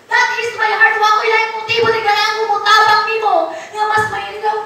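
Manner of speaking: fast, high, urgent preaching; quickened pastor's appeal; fervent preaching. Acted. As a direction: press the plea fast and high. Preaching at full force.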